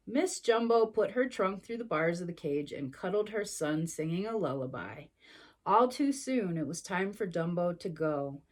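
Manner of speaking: ordinary delivery, nothing marked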